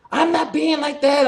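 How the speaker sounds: very high, loud, drawn-out mock protest; mad clerk imitation. Clerk denying he was rude, mock indignant. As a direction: mimic a defensive shopkeeper in a slow, high whine. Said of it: "he's all mad".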